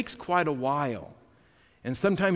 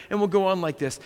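ordinary talk

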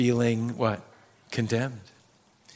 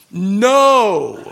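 a loud mock No breaking into laughter; laughing refusal; 11 semitones higher, 4 dB louder than usual. Pastor refusing Peter's request, laughing. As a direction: deny it, then crack up.

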